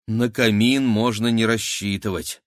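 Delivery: ordinary delivery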